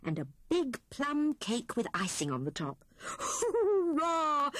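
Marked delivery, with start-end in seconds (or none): 3.0-4.5 much higher cheer with a laugh; Hare's laughing hurrah. A narrator's reading. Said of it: "cried Hare".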